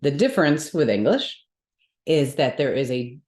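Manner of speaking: ordinary talk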